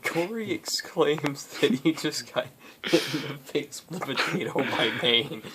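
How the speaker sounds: usual speaking voice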